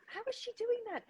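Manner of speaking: high, dropping to a hushed gasp of disbelief; imitating a stunned friend repeating how; high astonished murmur; amazed friend. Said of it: "she just kept saying".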